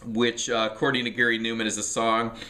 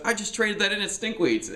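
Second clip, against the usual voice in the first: much higher mock voice of his buddy; imitating friend Jesse; 12 semitones higher than usual. Friend Jesse, seeing the box set, blurts out a high mock voice. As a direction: pitch it way up to play the friend. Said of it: "and he goes".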